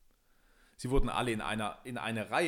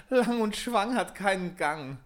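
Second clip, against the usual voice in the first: high, slow, singsong mockery of a suitor; mocking princess; 9 semitones higher, 6 dB louder than usual. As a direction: tease him in a lofty high voice. Spottende Prinzessin, hohe Stimme. (hohe, langsame, spöttische Prinzessinnenstimme)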